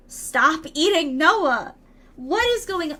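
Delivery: loud, drawn-out mock telling-off; exasperated scolding. Streamer to a misbehaving viewer, in exasperated scolding.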